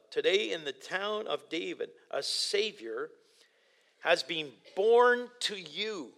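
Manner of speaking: "a Savior" and "has been born to you" drawn out slowly with pauses; slow, weighty key verse. Shown as a demonstration. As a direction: let each word of the key verse land, slowly. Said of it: "that's the key verse".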